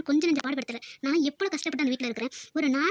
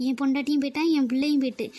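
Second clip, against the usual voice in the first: ordinary delivery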